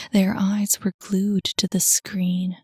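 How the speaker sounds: normal talking voice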